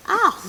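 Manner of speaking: mock scream. Play scream during attack.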